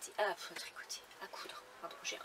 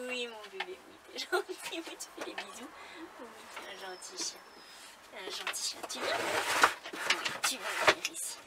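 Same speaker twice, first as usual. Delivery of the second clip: talking to a dog; 5 dB louder than usual. Real, not acted. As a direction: coax the dog over sweetly. Coaxing the dog to come.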